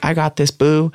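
high-pitched, sassy put-on confidence; hammed-up cool self-assurance; mock breezy bravado. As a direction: toss it off high like playful bravado. Acts out breezy bravado he lacked.

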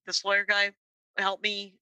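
ordinary talk

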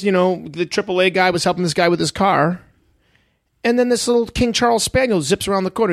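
normal talking voice

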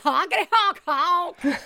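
high, loud silly honking; silly clown. Pretend. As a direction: honk like a goofy clown.

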